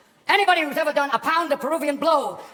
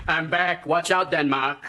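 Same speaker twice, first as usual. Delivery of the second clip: Schwarzenegger impression; 9 semitones lower, much slower than usual. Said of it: "Schwarzenegger walks into the room".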